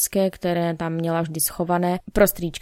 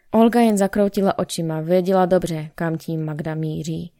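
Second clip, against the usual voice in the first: ordinary delivery, nothing marked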